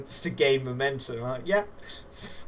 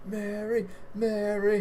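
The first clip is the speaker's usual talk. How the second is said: higher, voiced fan calling her name; adoring crowd member; 9 semitones higher, slower than usual. Leather-clad fans in the crowd to Mary, chanted calling. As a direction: call out like a devoted fan. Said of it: "who are just like".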